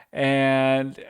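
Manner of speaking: usual speaking voice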